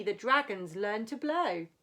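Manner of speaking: ordinary delivery, nothing marked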